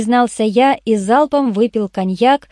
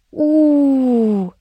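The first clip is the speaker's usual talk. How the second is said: long wailed "oo", sliding down; despairing howl; 4 semitones higher than usual. A narrator's reading. Boss learns he was spied on, howling. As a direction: howl in exasperation. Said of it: "завыл я".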